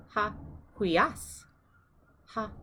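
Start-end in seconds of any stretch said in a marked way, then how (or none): 0.7-1.4 "hui as" stressed on AS; hui-AS, end syllable strongest; stress on second syllable. Shown as a demonstration. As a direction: lean on the final "as" in "hui as". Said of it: "accent is on the second syllable".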